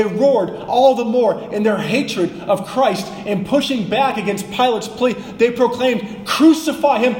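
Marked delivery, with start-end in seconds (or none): 6.2-7.1 loud, high roar; even louder shout. Retold or imitated. Preacher voicing the crowd roaring back at Pilate, loud high shout. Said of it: "even louder".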